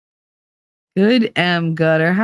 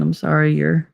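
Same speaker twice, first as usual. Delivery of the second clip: ordinary talk, nothing marked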